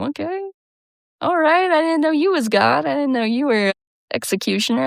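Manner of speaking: sarcastic. Real, not acted. Sarcastic comeback to judgment.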